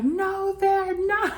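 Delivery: very high, loud mimicked denial; mock protest. Recapper mimicking a castmate contradicting the jealousy claim, high mock protest. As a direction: squeal the denial in an exaggerated imitation. Amused imitation of Natalie's retort.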